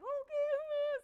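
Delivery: high, loud startled okay. Retold or imitated. Contestant answering trainer, startled and loud. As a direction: jolt into it. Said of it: "I'm like".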